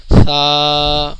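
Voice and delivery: سا as th, loud, long level aa; tongue on upper teeth for a held سا; no whistle. Shown as a demonstration. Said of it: "زبان اوپر کے دانتوں کو touch کرے گی".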